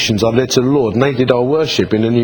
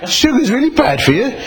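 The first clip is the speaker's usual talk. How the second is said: much higher, incredulous mock outrage; mock indignant; 10 semitones higher than usual. Preacher mocking the sugar police to the congregation, high incredulous. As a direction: play it up as baffled indignation. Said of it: "And I'm like".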